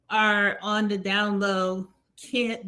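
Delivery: ordinary talk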